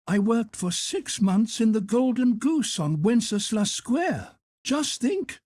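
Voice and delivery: high, brisk matronly hotel cook; head cook voice. Acted. Head cook offering Carl a job, high and bustling. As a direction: lift the pitch high for the kindly head cook.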